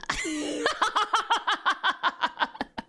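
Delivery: high, long villain cackle; maniacal laugh. Acted. Anaconda taking up his brother's mantle, maniacal laugh. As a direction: cackle like a madman. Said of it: "He laughs maniacally".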